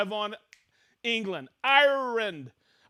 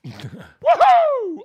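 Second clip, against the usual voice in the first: loud woohoo; 13 dB louder than usual. Real, not acted. Cheering on the live stream.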